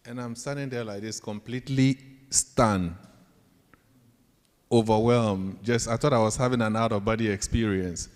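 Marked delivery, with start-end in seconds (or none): none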